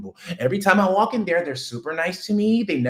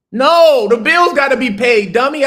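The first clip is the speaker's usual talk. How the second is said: sudden loud, higher outburst; loud scoff; 9 semitones higher, 10 dB louder than usual. Real, not acted. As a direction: bark it like it's obvious. Scoffing at his own question.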